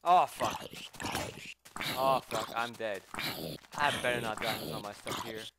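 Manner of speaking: loud panicked cursing; panicked shout. Real, not acted. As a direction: yell in panic. Dying in game.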